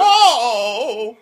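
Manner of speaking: high mock wail; mock crying. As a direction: imitate a drawn-out sob. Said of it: "you're fucking crying about".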